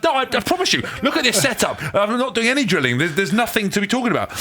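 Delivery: high, pleading protest of innocence; panicked denial. Retold or imitated. Retold desperate denial to girlfriend.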